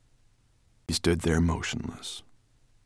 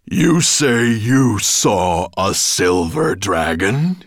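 slow, booming question from a mighty master; 3 semitones higher, 9 dB louder, much slower than usual. A narrator's reading. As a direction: boom it out word by word. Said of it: "His voice boomed through the ancient vaults".

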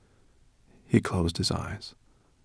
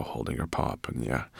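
ordinary delivery, nothing marked

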